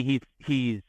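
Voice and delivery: ordinary talk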